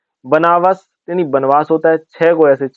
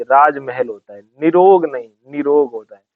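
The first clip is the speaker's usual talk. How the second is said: ordinary delivery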